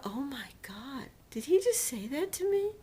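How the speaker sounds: breathless gasp rising to a high squeak; shocked whisper climbing high; stunned disbelief. Retold or imitated. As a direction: caught off guard, can't believe the insult. Shocked thought at surgeon's words.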